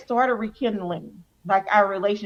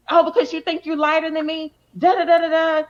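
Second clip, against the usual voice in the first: higher, louder imitation of an angry mother; mad mom; 6 semitones higher, 4 dB louder than usual. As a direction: mimic mom's tirade trailing into nonsense syllables. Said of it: "my mom would get mad at me".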